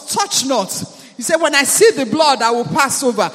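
loud declaring prayer over music; fervent prayer. Acted. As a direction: lead the church in loud thanksgiving.

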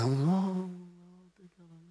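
very soft moan fading to nothing; timid, imitated. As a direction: mimic a feeble response, barely audible. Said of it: "Say that boldly now".

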